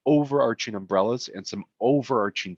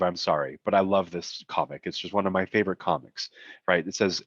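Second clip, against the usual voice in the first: ordinary talk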